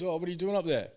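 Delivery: lightly acted lizard calling up; joke lizard's question. Lizard asks koala up the tree, lightly acted curiosity.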